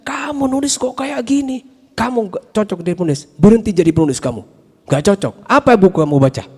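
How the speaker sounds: imitating a mentor tearing him apart, high and fast; a lightly voiced scornful mentor; harsh teacher scolding. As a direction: dismiss the student's writing with contempt. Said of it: "Langsung dihabisi saya".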